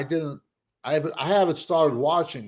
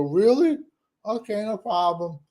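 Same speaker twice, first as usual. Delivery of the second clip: sarcastic reply; 7 semitones higher than usual. Retold or imitated.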